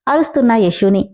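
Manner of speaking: ordinary delivery, nothing marked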